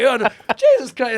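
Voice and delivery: mock swearing outburst, loud and high. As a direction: blurt it out of nowhere, loud and high. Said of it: "he'd go, like".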